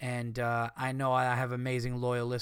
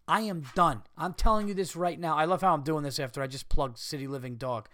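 usual speaking voice

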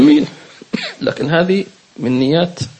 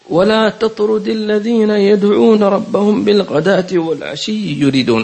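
slow, raised recitation of the verse; Quran recitation; 6 semitones higher than usual. Acted. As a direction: recite it with reverence, drawn out. (ترتيل بصوت مرتفع وبطيء)

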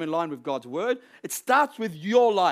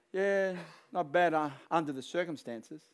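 mock downcast reply; 2 semitones lower, 6 dB quieter than usual. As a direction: answer as if weighed down by life. Acts a downcast reply to greeting.

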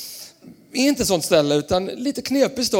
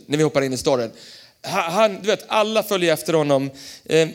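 normal talking voice

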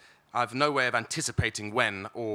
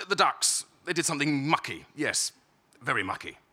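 a rushed, raised burst about the ducks; fervid muttering; 5 semitones higher, 5 dB louder than usual. A narrator's reading. Caught dunking the yacht, he blurts loudly to the child. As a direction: babble it urgently and louder, half to himself. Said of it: "muttering fervidly".

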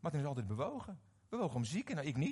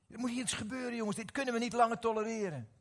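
higher-pitched, urgent protest; acted indignation; 7 semitones higher, 4 dB louder than usual. Preacher acts out anger as a calling, indignant. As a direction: protest as if fed up with injustice. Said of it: "Boosheid is een roeping".

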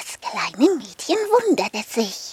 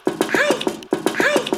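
shouting stop; 11 semitones higher than usual. Acted. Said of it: "aufhören rufen".

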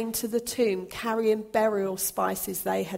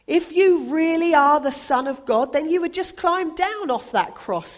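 loud, much higher sneering taunt; 6 semitones higher, 7 dB louder than usual. A narrator's reading. Mocking crowd daring Jesus to climb down, loud taunt. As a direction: jeer at him from below.

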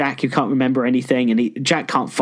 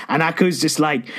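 normal talking voice

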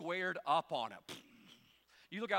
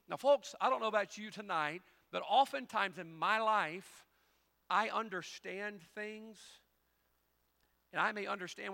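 loud preaching, slowing down; preaching at full force; 7 semitones higher, 9 dB louder than usual. Acted. Pastor pleading with his folks, loud and slow. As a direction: lean in and drive it home slowly.

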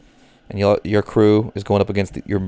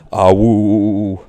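howling like a wolf pup; 3 dB louder than usual. Pretend. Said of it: "That was terrible, [name]".

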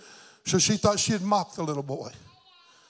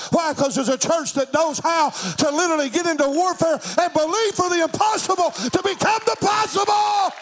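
driving revival cadence, climbing steadily higher; full-force preaching; 5 semitones higher than usual. Real, not acted. Preacher rouses church to believe for miracles, building to a shout. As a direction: build relentlessly to a high shouted climax.

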